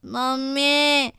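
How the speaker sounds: slow, odd monkey-like call of mummy; langur voice. Acted. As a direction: stretch it out like a langur calling. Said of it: "लंगूर की आवाज़ सुनकर". (लंगूर जैसी खिंची आवाज़ में mummy)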